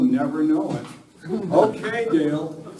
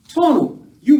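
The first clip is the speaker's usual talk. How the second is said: higher, louder call; 9 semitones higher, 7 dB louder than usual. Acted. Rider hailing Tonto returning with two men, calling. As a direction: hail him from afar.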